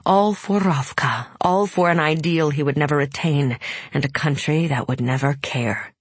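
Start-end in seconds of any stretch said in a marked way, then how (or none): none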